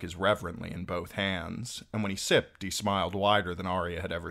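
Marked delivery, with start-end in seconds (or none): none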